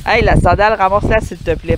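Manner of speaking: loud call across the field. Real, not acted. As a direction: shout over to him. Calling to companion from afar.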